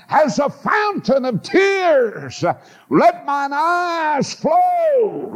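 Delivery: high, very slow chanted verse; preached scripture. Real, not acted. Quoting Jeremiah, preaching.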